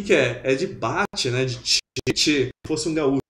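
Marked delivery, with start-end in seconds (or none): none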